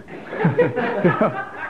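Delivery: high burst of real laughter; laughing. Real, not acted. Speaker tickled by the Birdcage bit, laughing high.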